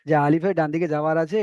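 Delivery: normal talking voice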